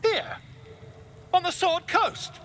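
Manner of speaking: high-pitched, halting disbelief; stunned and theatrical, pitched well up; incredulous. Acted. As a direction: react in astonishment, pausing between phrases. Astonished at news of mind flayers.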